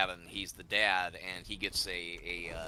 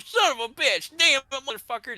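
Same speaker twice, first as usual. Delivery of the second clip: much higher, louder hammed-up cursing; mock rage curse; 13 semitones higher, 6 dB louder than usual. Host replaying a teammate's in-game rage, mock furious. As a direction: throw the curses out like a cartoon tantrum. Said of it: "totally keyed into the, the delivery".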